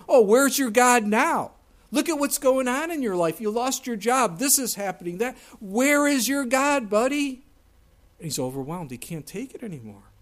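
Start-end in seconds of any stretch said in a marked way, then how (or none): 0.1-7.4 taunting. Retold or imitated. Mockers taunting David.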